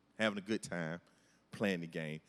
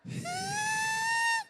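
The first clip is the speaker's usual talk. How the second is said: big shrieking laugh at own story. Real, not acted. Laughing at crying technique.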